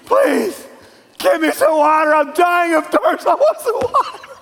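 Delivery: high-pitched, hammed-up desperate pleading; begging and pleading. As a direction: beg frantically, voice climbing very high. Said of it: "then you beg, and you plead".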